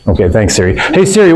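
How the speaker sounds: usual speaking voice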